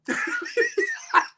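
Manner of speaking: high breathy burst of laughter; a sudden squeal of laughter. Real, not acted. Laughing again at grandma's fight line. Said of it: "I don't know why that makes me laugh".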